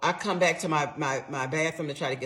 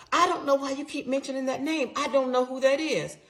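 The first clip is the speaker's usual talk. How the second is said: husband; 10 semitones higher, faster than usual. Retold or imitated. Husband denying, voiced in retold story.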